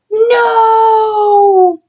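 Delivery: long, high, loud wail of "no"; dismayed cry. Acted. Buddy reacts to bad news.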